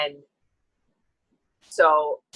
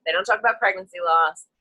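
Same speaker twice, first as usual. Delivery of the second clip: ordinary delivery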